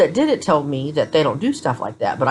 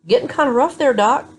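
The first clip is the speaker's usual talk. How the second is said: sassy warning; 8 semitones higher than usual. Retold or imitated. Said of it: "and I'm like".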